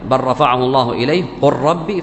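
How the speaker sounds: بل رفعه and قل ربي each merged with doubled R; idgham merging. Shown as a demonstration. Teacher restating the basic rule, بل رفعه and قل ربي run together. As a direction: drop the L in بل and قل into the following R. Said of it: "فإنه يدغم اللام عند الراء".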